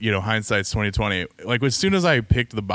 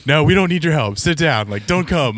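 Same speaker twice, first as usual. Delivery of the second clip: mock insistent no; 6 semitones higher, 6 dB louder than usual. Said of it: "Beth's like".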